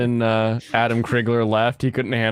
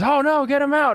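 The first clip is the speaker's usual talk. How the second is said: wife voice much higher, panicked; shrill mock wife; 12 semitones higher than usual. Quoting the wife's panicked cry within the bit. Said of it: "my wife says".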